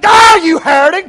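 screamed curse. Retold or imitated. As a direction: scream it like a zealot. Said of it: "he hollered out and screamed at her".

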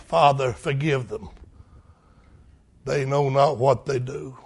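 soft, low tender plea; Jesus forgiving softly from the cross; hushed prayer. Acted. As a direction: murmur it with pity. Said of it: "Can you hear him crying".

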